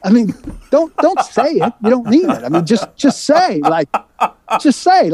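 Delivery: high-pitched, exasperated retold argument; exasperated challenge. Reporter retelling his argument with an agent, exasperated.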